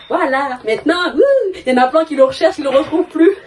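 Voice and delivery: fast, giggly boasting; laughing. Real, not acted. Telling viewers everyone wants her pair, laughing. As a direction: laugh through it, proud of your luck.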